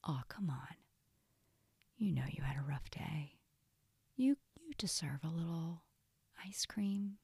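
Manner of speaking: soft, hushed, half-whispered temptation; sneaky coaxing inner voice. Acted. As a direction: coax softly like a tempter in the head. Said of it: "the voice will say".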